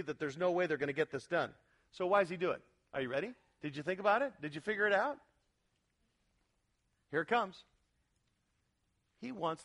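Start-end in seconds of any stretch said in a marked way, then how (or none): none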